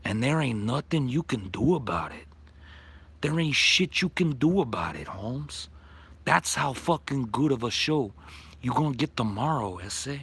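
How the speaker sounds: put-on Chicano gangster voice, tough. Mock Chicano gangster voice rant.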